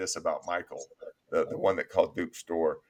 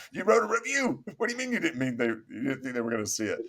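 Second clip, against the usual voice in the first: incredulous; 5 semitones higher than usual. Retold or imitated. Retelling disbelief at fired employee.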